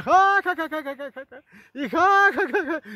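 imitating a loud, high jeering laugh; mocking laughter. Rival vendor laughing at his spilled empanadas, mocking laughter. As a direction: laugh at him as if his loss is a joke. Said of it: "riéndose, burlándose de mí".

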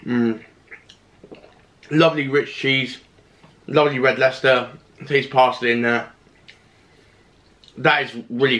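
chewing while talking; talking between bites; mouth full. Real, not acted. Seen 0: Bite at -3; closed-lip chewing between phrases, mouth working throughout.

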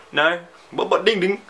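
comic attempt at an Indian accent. Shown as a demonstration. Vlogger still trying after doubting himself, mock Indian accent. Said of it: "if I headed over to India".

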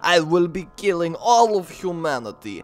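put-on hammy Transylvanian vampire accent; mock-Dracula accent, relishing the villainy; bad vampire accent. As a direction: play a vampire count with a wobbly Transylvanian accent. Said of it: "my vampire accent is not very good".

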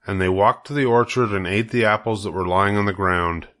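plain reading voice

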